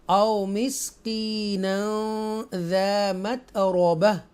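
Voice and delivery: slow, drawn-out tajweed chant; Quran recitation. Shown as a demonstration. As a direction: recite each word slowly and melodically. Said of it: "ऐसे पढ़ेंगे".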